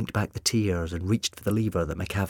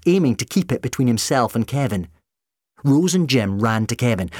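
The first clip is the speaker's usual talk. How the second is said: usual speaking voice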